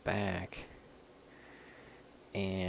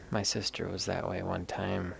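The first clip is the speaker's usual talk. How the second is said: normal talking voice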